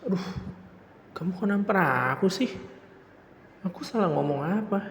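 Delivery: high, stunned protest after being slapped; shocked after a slap. Acted. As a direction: yelp then ask what you said wrong. Just slapped by girlfriend.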